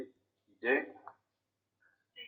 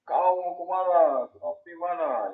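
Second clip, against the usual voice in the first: slow, much higher, loud call across open ground; shouting across a distance; 7 semitones higher, 6 dB louder, slower than usual. Shown as a demonstration. Teacher demonstrates how eastern Papuans call out, a slow loud shout. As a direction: bellow it out slowly as if to someone far away. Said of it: "itu harus teriak".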